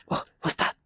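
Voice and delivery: breathy whisper of fake surprise; feigned innocence. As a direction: play dumb in a hushed whisper. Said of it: "will act like".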